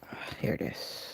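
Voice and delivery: breathy whisper to herself; whispered find. Real, not acted. Whispering to herself after finding the item. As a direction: murmur it to herself as she finds it.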